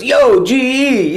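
loud, high, drawn-out street hail; garbage man's greeting. Retold or imitated. Garbage man hailing a celebrity on the street, loud and drawn out. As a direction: call it out like a big friendly garbage man. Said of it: "he goes".

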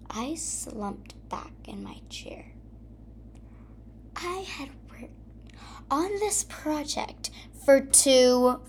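whispered, slumping in defeat; deflated whisper. A narrator's reading. Narrator hearing the project failed, whispering. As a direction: let it sag out in a whisper.

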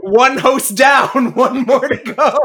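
loud, drawn-out gloat breaking into laughter; mock villain gloating. Host joking about taking the throne, loud mock gloating. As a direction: crow it slowly and loudly, half laughing.

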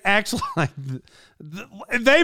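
stumbling over the words; false starts, tripping on the first sound; stotternd. Real, not acted. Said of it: "I'm sorry for stuttering".

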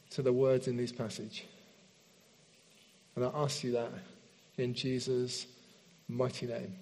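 soft, low praying voice; hushed prayer. Real, not acted. Leads a prayer.

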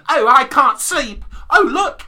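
put-on high, loud, slow little-girl voice; hammed-up posh child, talking to the audience; fancy childish voice. Host plays sleepless Stella talking to us, fancy childish voice. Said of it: "That was meant to be fancy".

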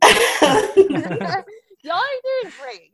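slow, stretched-out praise; slow drawn-out praise. Real, not acted. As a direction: draw out each word warmly. Host praising contestants slowly.

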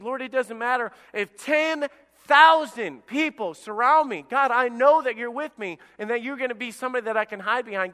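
high, loud, fervent prayer; bold declaration of faith. Acted. David surrounded by thousands declaring trust in God, fervent.

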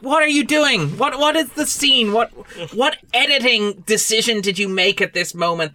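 high-pitched incredulous questioning; exasperated disbelief. Acted. Viewer questioning the film-makers' editing, acted exasperation. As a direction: demand answers in baffled protest. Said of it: "And you're like".

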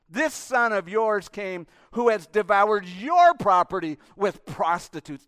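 loud, slow, contemptuous accusation; elder son accusing his father; disdainful son. Acted. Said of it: "The disdain".

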